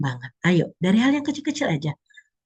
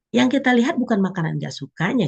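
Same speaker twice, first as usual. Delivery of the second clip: ordinary delivery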